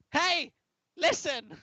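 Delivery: very high, loud fairy squeal. Acted. Host attempting the game fairy's call, high fairy voice. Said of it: "I can't do a fairy voice".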